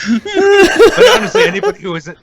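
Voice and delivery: high, laughing start. Real, not acted. Praising Dankula's channel, still laughing. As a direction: push the words out through laughter. Recovering from laughing at own joke.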